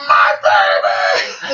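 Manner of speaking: drawn-out, rasped mock scream; horrified mother's cry. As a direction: scream it slowly as a frantic mother. Mother seeing baby, retold.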